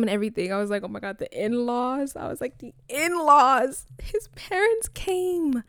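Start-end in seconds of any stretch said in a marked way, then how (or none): 2.6-3.9 "in-laws" high, slow and loud; dramatic in-laws. Retold or imitated. Second dramatic take. 4.0-5.6 high slow loud awe; dramatic. Retold or imitated.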